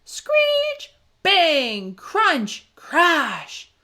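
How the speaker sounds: high, loud punchy noise words; storyteller making crash noises; crash sound effects. A narrator's reading. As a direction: bang each one out like a crash.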